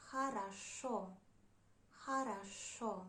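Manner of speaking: Хорошо said carefully as "ha-ra-SHO"; unstressed O as A. Shown as a demonstration. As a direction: stress the last O of Хорошо, the others as "a". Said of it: "we pronounce it like A".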